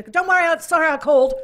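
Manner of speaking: high, loud mock apology; panicked bail-out. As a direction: blurt it loud and high to escape the call. Imagined panicked bail-out from sales call.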